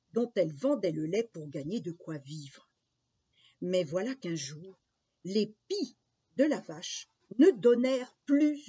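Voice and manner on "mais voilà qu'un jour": usual speaking voice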